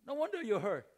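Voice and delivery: usual speaking voice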